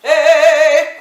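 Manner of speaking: high, very loud belted call; belting. Shown as a demonstration. Voice teacher demonstrating for a class how people belt, belted shout. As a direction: belt it out with full throat tension. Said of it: "people who like to belt".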